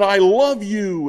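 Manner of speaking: high, loud, drawn-out call; retold yell. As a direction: shout it out slowly like a boy calling across a mountain. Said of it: "yell something different".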